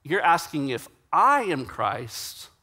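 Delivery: "I" lifted and stretched midway through; slow line with "I" as its peak; stress on I. Retold or imitated. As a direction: lean slowly on the word "I". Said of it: "puts the emphasis on, on the I".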